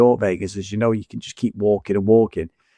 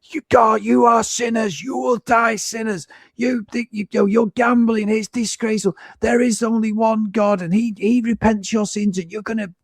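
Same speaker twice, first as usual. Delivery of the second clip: imitating street preacher; 10 semitones higher than usual. Teller mimicking the Vegas street preacher, high and preachy. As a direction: ham up a ranting street preacher. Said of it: "he's like".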